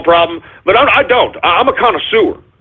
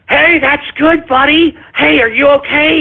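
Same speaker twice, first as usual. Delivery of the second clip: higher, put-on sugary voice mocking fake support; mock cheerful pat-on-the-back; 9 semitones higher than usual. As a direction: pretend to cheer on a recovering addict, sarcastic. Mocking sympathy for recovering alcoholics.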